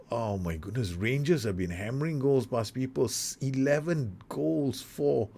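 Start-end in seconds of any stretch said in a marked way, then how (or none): none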